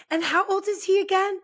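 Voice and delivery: high, lightly mocking question. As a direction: ask it in a high voice, poking fun. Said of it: "she was like".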